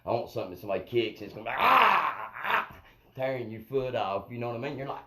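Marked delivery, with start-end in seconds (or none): 1.6-2.8 loud, high-pitched "ah" bursts; imitated attack-dog yell. Kicked dog tears your foot off, mock attacking yell.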